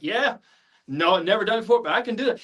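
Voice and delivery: mock-confident can-do reply; eager overconfidence. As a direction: agree instantly, overselling yourself. Acting out overconfident reply to agent.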